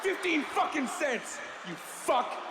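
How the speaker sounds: high, raging leather-jacket tough guy screaming at the viewer; furious tough guy. Acted. Leather jacket guy berating stingy viewers, furious yelling. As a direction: scream it like you want to shake them. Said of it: "Then leather jacket guy comes out".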